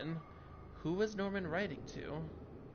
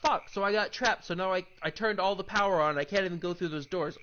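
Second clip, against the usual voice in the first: loud, higher frustrated rant; angry outburst; 4 semitones higher, 10 dB louder than usual. Real, not acted. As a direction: vent at wasting the effort. Angry at being trapped again.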